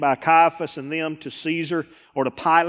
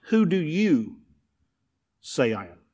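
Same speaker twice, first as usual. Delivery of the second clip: ordinary talk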